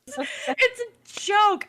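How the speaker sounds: high, drawn-out wail through laughter; flustered, laughing protest; laughing excuse. Real, not acted. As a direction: whine it while cracking up.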